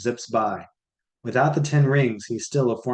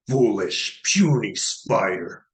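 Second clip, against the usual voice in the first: sneering villain; 2 semitones lower, slower than usual. Acted. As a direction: belittle him slowly. Maestro scorns Peter.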